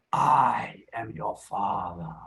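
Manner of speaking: low, slow, heavy Vader voice; teacher's low, slow Sith Lord voice for the class; Darth Vader impersonation. Shown as a demonstration. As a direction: drop low and drag out each word like Vader. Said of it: "my Darth Vader impersonation".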